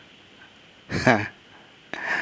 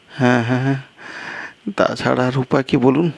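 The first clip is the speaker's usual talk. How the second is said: a second character pitched higher, exasperated; higher-voiced guest; 8 semitones higher, faster than usual. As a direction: play the flustered guest, voice raised in pitch.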